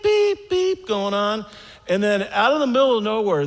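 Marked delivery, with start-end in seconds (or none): 0.0-1.5 high, slow beeping; imitated beeps. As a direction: beep it out like the monitor. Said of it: "I got the beep".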